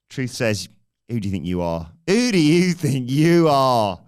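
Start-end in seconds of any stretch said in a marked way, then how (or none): 2.1-4.0 very loud, high and drawn-out yell; outraged shout. Acted. Wrestler challenging a rival who questioned him, outraged bellow. Truth's retort repeated as a shout.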